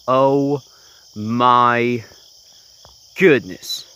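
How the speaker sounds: loud, drawn-out astonished gasp of words; amazed exclamation. Real, not acted. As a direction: blurt it out in real amazement. Huge skunk caught in trap.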